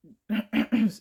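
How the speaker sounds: loud throat clear. Real, not acted. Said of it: "excuse me".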